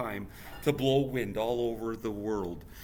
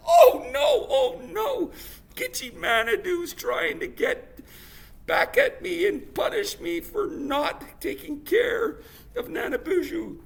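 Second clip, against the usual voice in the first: alarmed cry; 8 dB louder than usual. Acted. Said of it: "she was like".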